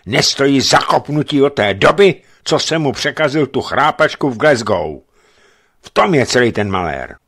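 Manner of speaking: furious officer ranting about Farabi; angry rant. A narrator's reading. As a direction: spit out the grievance. Bennett rants back.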